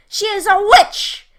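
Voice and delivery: high, loud, alarmed shout from the cage; frightened cry. A narrator's reading. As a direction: cry out that she is a witch. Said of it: "Hansel cried".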